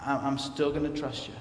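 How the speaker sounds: low, soft plea to God; softly confiding in the Lord; quiet prayer. Acted. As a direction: drop into a hushed, trusting lament. Psalmist's quiet prayer amid lament.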